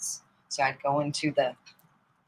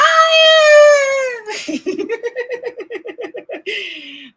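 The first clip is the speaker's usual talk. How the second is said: high loud screaming laugh; shrieking laughter; 11 dB louder than usual. Real, not acted. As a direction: howl with laughter. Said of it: "Oh, we laughed".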